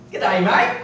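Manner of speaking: a loud, lightly Aussie "Good day, mate"; mock Australian hello; Australian greeting. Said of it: "you know how the Australians are".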